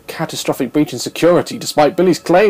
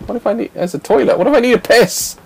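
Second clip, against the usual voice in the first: ordinary talk, nothing marked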